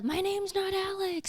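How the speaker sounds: high whiny movie-character quote; high-pitched mock protest; movie line impression. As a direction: plead it in a high strained voice.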